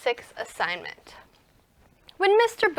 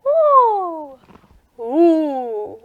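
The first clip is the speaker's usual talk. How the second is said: vocal slide; 3 semitones higher, 6 dB louder than usual. Shown as a demonstration. Teacher leading kids along Mister Boo's path, sliding. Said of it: "when he's in the middle".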